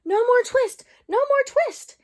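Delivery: very high, loud squeaky shout; high squeaking mouse chorus; twittering mouse shout. A narrator's reading. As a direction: shriek it like a crowd of tiny mice. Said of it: "shout at once in the little twittering voices".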